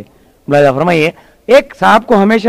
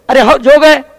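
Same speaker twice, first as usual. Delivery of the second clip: louder, much higher protest; exasperated insistence; 7 semitones higher, 5 dB louder than usual. Acted. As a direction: insist desperately that it really counts. Comedian insists to unamused wrestler. (झल्लाकर ज़ोर देते हुए)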